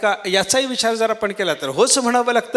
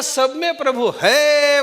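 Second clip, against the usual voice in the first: slow, higher braying chant; donkey bray; 6 semitones higher, slower than usual. Retold or imitated. Preacher imitating the braying donkey again. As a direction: bray it out long. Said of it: "त्याचं सांगणं असतं".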